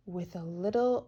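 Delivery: ordinary delivery, nothing marked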